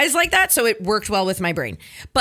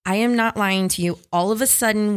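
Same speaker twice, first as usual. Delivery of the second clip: normal talking voice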